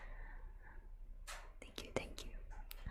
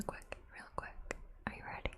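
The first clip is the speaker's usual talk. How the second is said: close-up ASMR; 4 dB quieter than usual. Real, not acted. Streamer moving close to grant a viewer's request, ASMR whisper. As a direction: breathe it softly right into the listener's ear. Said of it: "I'll need to get really close to your ears".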